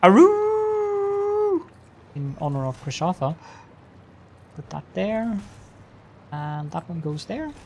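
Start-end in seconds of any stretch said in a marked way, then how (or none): 0.0-1.6 long, high drawn-out "aroo"; high held hoot; ceremonial howl. Pretend. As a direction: let out a high, sustained howl in honor. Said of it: "I guess I should do Aru".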